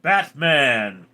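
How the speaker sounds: usual speaking voice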